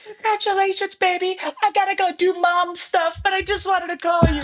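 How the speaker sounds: busy mom calling a friend, sweet and high; Sherry. Retold or imitated. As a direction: coo congratulations like a loving busy mom. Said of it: "in her beautiful Sherry way".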